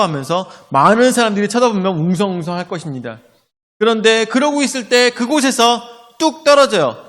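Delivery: ordinary talk, nothing marked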